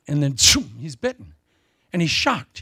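ordinary talk

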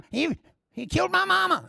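very high, hammed-up squeal; mock hysterical accuser. Accuser inventing a crime to the crowd, high mock hysteria.

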